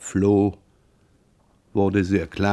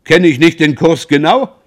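high, loud proud boast; beaming triumph; 5 semitones higher, 8 dB louder than usual. A narrator's reading. Beausire boasting to the ladies about his course, beaming loud. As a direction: crow about being right. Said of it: "wiederholte strahlend".

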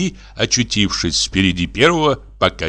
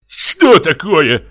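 slow, raised, louder demand; flushed, indignant question; 7 semitones higher, 6 dB louder, much slower than usual. A narrator's reading. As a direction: ask it drawn out and affronted. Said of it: "спросил покрасневший Егор Егорыч". (возмущённый, протяжный вопрос)

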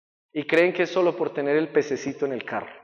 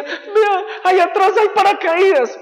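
high, loud anxious attendant; flight attendant; 8 dB louder than usual. Acted. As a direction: point urgently to the parachutes. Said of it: "le dice ella".

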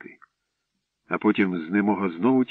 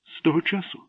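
higher, hushed mysterious question; mysteriously; 9 semitones higher than usual. A narrator's reading. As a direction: ask it like sharing a secret. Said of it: "таємничо запитала дівчинка". (таємничо, тихіше)

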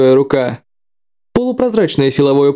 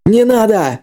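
sharp warning cry, higher; sudden shout; 7 semitones higher, slower than usual. Acted. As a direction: yell it out to stop him. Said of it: "внезапно крикнул ему".

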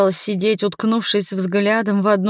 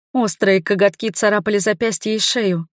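plain reading voice

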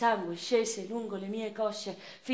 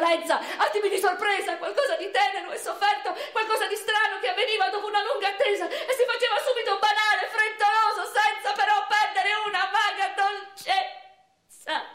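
much higher, laughing through the words; half-laughing, half-overwhelmed recollection; breathless laughing; 13 semitones higher, 5 dB louder than usual. Acted. A bride recalling her wedding night, laughing high and breathless.

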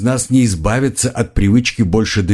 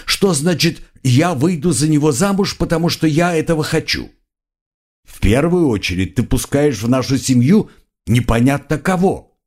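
exasperated outburst; 6 semitones higher than usual. Acted. Monbron argues, waving arms.

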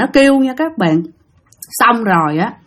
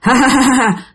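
acted mocking ghost laugh; ghostly cackle. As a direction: cackle "ha ha ha" after the taunt. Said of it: "nó vừa cười hà hà hà vậy đó". (tiếng ma cười hà hà)

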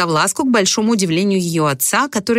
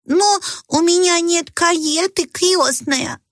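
little Cinderella; 11 semitones higher, slower than usual. A narrator's reading. Cinderella lines in play.

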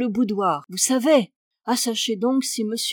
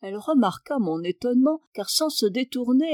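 ordinary delivery, nothing marked

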